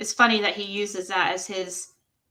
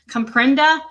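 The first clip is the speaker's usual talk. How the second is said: loud, higher mocking repeat with a rising end; mocking echo; 4 semitones higher, 8 dB louder than usual. Shown as a demonstration. Echoing Don's text back with loud mockery. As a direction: throw his word back at him in disbelief.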